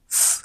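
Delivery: "f" as a bare voiceless hiss; isolated consonant sound. Shown as a demonstration. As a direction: sound out "f", air with no voice. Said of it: "it is pronounced as f".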